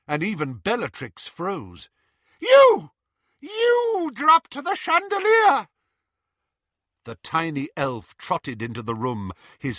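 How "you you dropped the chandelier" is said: much higher, louder, slow accusing shriek; furious shriek. A narrator's reading. Bellatrix at Dobby, furious shriek. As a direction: spit the accusation in outrage.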